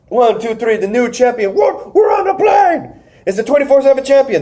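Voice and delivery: high-pitched frantic ref's count and announcement; excited referee. Retold or imitated. Referee crowning a champion on a plane, high excited call. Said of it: "The referee's telling him".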